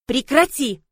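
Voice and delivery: put-on loud, firm order to a child; sharp scolding. A narrator's reading. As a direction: tell the child off loudly. Scolds growling child, shaking her. (строгий окрик ребёнку)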